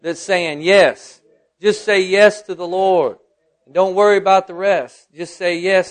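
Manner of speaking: loud, high preacher's declaration; forceful pulpit proclamation; impassioned preaching. Real, not acted. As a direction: preach it out, stretching the key words.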